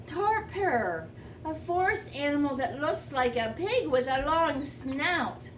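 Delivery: ordinary delivery, nothing marked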